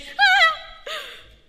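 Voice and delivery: shrill cartoon giggle; high silly laugh. [name]'s reaction.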